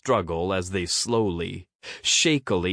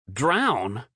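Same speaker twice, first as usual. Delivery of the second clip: scoffing; 4 semitones higher than usual. A narrator's reading. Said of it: "he scoffed".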